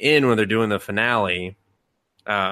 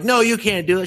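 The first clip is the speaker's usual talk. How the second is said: mock scolding; 12 semitones higher, 5 dB louder than usual. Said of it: "they're like".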